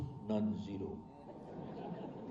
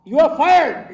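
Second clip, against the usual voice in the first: you are fired yelled much higher and louder; furious shout; 13 semitones higher, 13 dB louder, much slower than usual. Retold or imitated. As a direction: bark the firing at full volume. Manager firing him, shouted.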